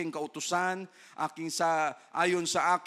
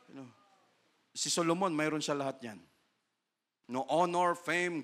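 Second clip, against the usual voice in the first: usual speaking voice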